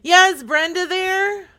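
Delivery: loud, high-pitched repeat to be heard. Pretend. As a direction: call it out down a bad phone line. Repeats after second hello, unheard.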